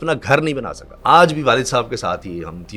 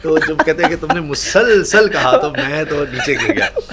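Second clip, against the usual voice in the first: laughing; 5 semitones higher, 5 dB louder than usual. Real, not acted. Laughing while retelling joke.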